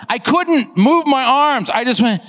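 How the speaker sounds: ordinary talk, nothing marked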